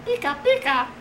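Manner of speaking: squeaky high creature cry; Pikachu voice. Retold or imitated. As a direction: chirp it like the little Pokemon.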